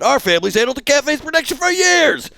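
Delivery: high, loud tough-guy bark; thug snapping. Pretend. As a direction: snap at him to shut up. Voices yakuza thug.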